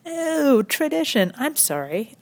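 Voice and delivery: high, drawn-out sarcastic "oh"; mock realization. Comedian answering the tradition excuse, mock realization. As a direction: feign being impressed, pitched high.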